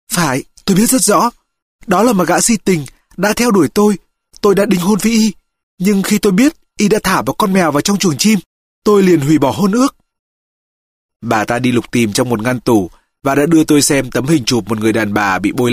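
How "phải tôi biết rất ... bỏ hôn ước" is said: high-pitched woman's voice, resentful; bitter, indignant mother. Acted. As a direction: play the aggrieved mother, pitched well up. (giọng người mẹ cao, đầy cay đắng)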